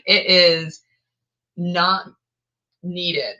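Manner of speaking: "It is" loud, pause, "not", pause, "needed" falling; spaced emphasis. Real, not acted. Vlogger dismisses other books, each word punched apart. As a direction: hit each word with a pause between them.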